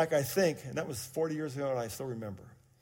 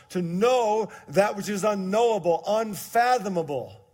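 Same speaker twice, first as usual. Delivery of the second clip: louder, raised scripture preaching; 5 semitones higher, 7 dB louder than usual. Acted. Pastor reading Ephesians to the church, forceful. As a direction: proclaim the verse to the church.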